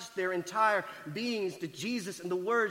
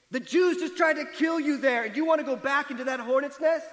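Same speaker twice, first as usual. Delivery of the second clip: incredulous disciples; 7 semitones higher, 7 dB louder than usual. Retold or imitated. Disciples balk at going back.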